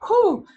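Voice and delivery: a high, loud "whew" holding back tears; shaky relieved exhale. Real, not acted. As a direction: blow out a breath to keep from crying. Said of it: "I'm trying so hard not to cry".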